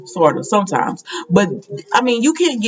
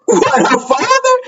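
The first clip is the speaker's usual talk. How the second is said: incredulous; 11 semitones higher than usual. Retold or imitated. Incredulous reaction in retold scene.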